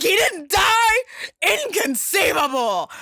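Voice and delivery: hammed-up disbelieving villain yell; Vicini shout. Vizzini shocked at climber.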